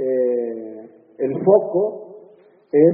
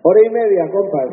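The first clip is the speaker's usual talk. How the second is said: ordinary delivery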